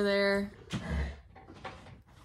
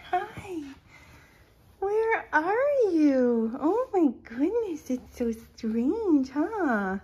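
high, drawn-out cooing to a cat; pet talk; 7 semitones higher, much slower than usual. Real, not acted. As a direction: coo slowly in a high, swooping voice.

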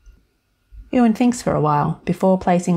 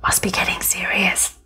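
audible whisper; 2 semitones higher than usual. Acted. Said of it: "lowers her voice to a still audible whisper".

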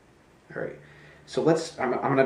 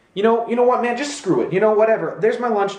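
raised, fed-up acted outburst; aggravated; 8 semitones higher, 5 dB louder than usual. Throwing money on the table, aggravated. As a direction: give up in exasperation, pitched up. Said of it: "I kinda got aggravated".

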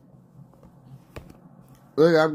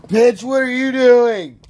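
high, loud demand; exasperated yell; 5 semitones higher, 6 dB louder than usual. Real, not acted. Player scolding his teammate, loud high yell.

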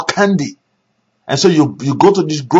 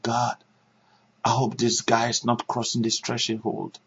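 much softer, murmured to God; hushed prayer; 5 semitones lower, 12 dB quieter than usual. Retold or imitated. Pastor quietly praying for the visiting preacher, hushed. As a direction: pray it under your breath.

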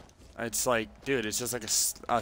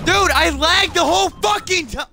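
very high furious shout; hyped gamer bro; 7 dB louder than usual. Real, not acted. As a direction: scream it out in rage. Raging at lag.

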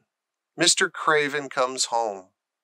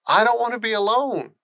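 higher, quick lonely plea; pleading, not bossy; 8 semitones higher, faster than usual. Acted. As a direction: drop the bossiness and beg. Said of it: "all trace of bossiness gone from his voice".

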